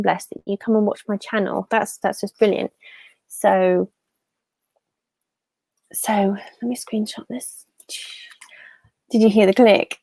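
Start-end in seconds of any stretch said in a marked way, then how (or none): 5.9-7.6 a soft, playful whisper. Real, not acted. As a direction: whisper it as if the click might be heard. Screenshotting quietly on stream.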